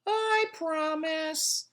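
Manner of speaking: high, slow sly coaxing; coaxing pigtail. A narrator's reading. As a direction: drawl it out to seduce him. Pigtail promises.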